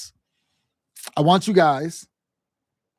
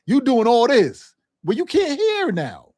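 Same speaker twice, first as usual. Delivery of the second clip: slow, high put-on voice of someone zoned out; mocking a distracted listener; 5 semitones higher, 3 dB louder, slower than usual. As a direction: act out the caller who stopped listening. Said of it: "you start going".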